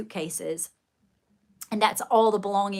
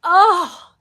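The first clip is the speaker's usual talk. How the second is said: a high, loud, scandalised "Oh"; offended gasp; 9 semitones higher, 12 dB louder than usual. Retold or imitated. Old lady at church reacting to the haircut, high shocked gasp. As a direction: gasp like she's clutching her pearls. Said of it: "She was so offended".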